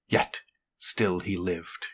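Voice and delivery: ordinary delivery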